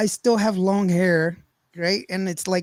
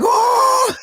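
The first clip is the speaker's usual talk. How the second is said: loud, very high startled howl; mock scream; 6 dB louder than usual. Nephew screaming back at aunt, mock terror. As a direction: imitate a long scream of fright. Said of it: "just screaming".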